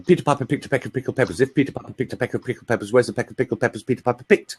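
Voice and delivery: rapid, precise tongue-twister recital; quick, even articulation exercise. Shown as a demonstration. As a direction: rattle it off fast as a diction drill. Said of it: "tongue twisters".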